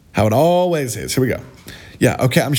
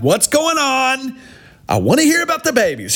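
higher, hammed-up eager voice; mock eager; 11 semitones higher than usual. To viewers, mocking interest in the babies story, mock eager. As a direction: pretend to be dying to hear it.

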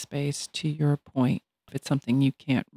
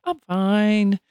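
high, sing-song fake cheer; pretending all's fine; 13 semitones higher than usual. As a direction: put on a much higher mock-breezy voice to cover hurt. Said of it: "we might pretend like".

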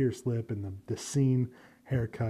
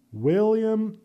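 usual speaking voice

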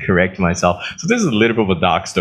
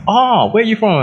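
mock American asking; 12 semitones higher than usual. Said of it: "America's like".

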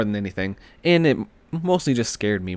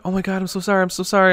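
high-pitched imitation of a flustered apology; apologetic lady; 7 semitones higher, faster than usual. Lady getting out after hitting him, imitated apologetically. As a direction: retell her hurried apology in a raised pitch. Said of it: "the lady's getting out all like".

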